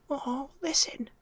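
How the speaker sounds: hushed bedtime whisper. Real, not acted. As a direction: whisper so you don't wake him. Hushed so Blue Rex sleeps.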